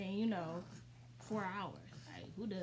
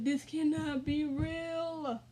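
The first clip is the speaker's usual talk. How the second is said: slow, higher tearful whine; mock crying; 6 semitones higher, 4 dB louder, much slower than usual. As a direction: wail it in drawn-out disbelief. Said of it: "I just got to crying".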